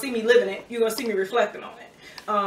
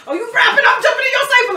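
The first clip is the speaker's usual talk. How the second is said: high, loud excited butting-in; retold eagerness; 13 semitones higher, 8 dB louder than usual. Younger self jumping into a rap cipher, imitated eager. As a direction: burst in as if claiming a spot.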